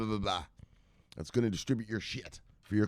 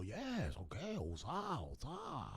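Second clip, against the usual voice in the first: soft, slow, high put-on executive voice; mock client murmur; 3 semitones higher, 16 dB quieter, much slower than usual. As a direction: murmur agreement as the mimicked clients. Mimics Chinese executives' reply.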